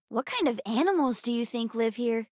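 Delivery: ordinary talk, nothing marked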